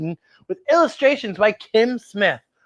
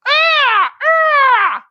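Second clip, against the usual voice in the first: high, loud screeching bird cry; vulture screech; 6 dB louder than usual. Shown as a demonstration. Host showing kids a vulture sound, loud high screech. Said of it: "Can you do a vulture sound".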